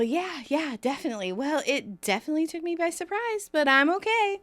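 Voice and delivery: rising, bright fake calm; a hint of forced nonchalance; forced cool. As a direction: play it cool, badly. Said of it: "tries to play it cool".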